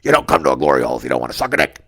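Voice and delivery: very low, fast, loud rough-guy voice; Mike character voice. Acted. Glory hole voicemail played for Seth's wife, deep fast character voice. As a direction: drop it deep and rattle it off. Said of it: "I do the voice".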